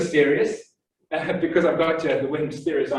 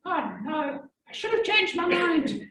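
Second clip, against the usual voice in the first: regretful quote; 13 semitones higher, 4 dB quieter, slower than usual. Retold or imitated. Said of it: "you're gonna say".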